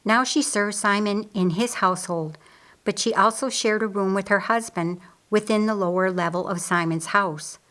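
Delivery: ordinary delivery, nothing marked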